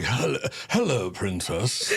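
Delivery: lightly done British butler greeting; a hint of a fancy British butler; British butler accent. Father answering daughter in a mock British butler accent. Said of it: "your British accent sounds like a butler".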